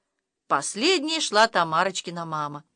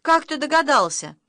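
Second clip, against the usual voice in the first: glad; 7 semitones higher, 4 dB louder than usual. A narrator's reading. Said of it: "обрадовалась Тамарочка".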